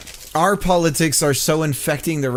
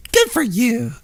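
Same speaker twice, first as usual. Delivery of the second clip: mock congratulation; 7 semitones higher than usual. Real, not acted. Sarcastic remark with laugh.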